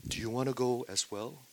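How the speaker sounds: soft question with no feeling; emotionless question. Shown as a demonstration. Preacher reading Jesus' question to the disciples, emotionless. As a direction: ask it quietly, without emotion. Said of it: "He has no emotion, no feeling".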